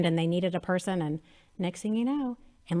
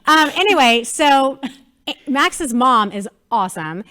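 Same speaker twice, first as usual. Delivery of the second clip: much louder and higher, bubbling over; excited, still laughing; 4 semitones higher, 9 dB louder than usual. Real, not acted. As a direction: pick the story back up while buzzing with fun. Laughing excitedly resuming story.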